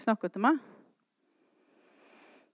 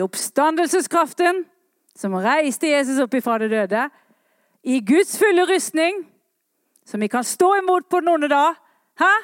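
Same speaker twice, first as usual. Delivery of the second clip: loud, high, rousing call from the pulpit; fiery revival preacher; full-force preaching; 7 semitones higher, 5 dB louder than usual. Acted. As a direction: proclaim it to the whole church, ringing out.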